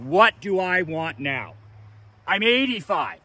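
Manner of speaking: ordinary delivery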